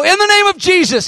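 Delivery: high, ringing command of faith; bold declaration. Retold or imitated. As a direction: proclaim it with full authority. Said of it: "and I go".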